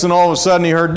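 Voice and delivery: ordinary talk, nothing marked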